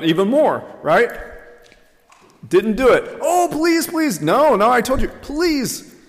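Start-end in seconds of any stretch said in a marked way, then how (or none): 3.2-4.2 high, hammed-up plea; begging. Students asking the professor for more time, mock begging. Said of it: "they begged and they begged". 4.2-5.1 higher, insistent brush-off; mock refusal. Professor turns down begging students, exasperated refusal. 5.1-5.9 high, hammed-up plea; begging. Students asking the professor for more time, mock begging. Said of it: "they begged and they begged".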